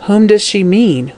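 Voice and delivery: plain reading voice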